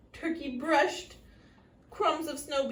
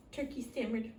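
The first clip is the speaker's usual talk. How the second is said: ordinary delivery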